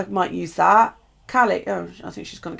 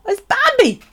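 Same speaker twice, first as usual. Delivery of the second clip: high, loud delighted squeal at Bambi; 9 dB louder than usual. Real, not acted. Finding a Bambi tag in the box, high loud delighted squeal.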